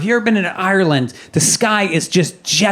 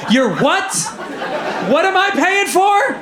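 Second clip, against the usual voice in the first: outraged dad; 8 semitones higher than usual. Acted. Father yelling at son.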